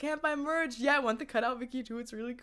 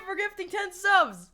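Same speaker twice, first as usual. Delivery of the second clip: normal talking voice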